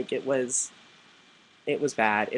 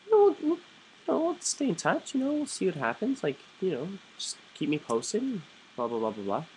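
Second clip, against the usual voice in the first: much higher, airy put-on brush-off voice; fake polite brush-off; 8 semitones higher than usual. Dodging a guy's movie invite, in a much higher fake-polite voice. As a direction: fob him off in a high sing-song.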